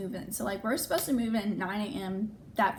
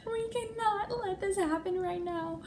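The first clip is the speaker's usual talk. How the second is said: much higher, wailing mock cry; crying friend; 11 semitones higher, slower than usual. Shelby at the bar, crying over the news, high wail. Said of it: "Shelby's at the bar crying".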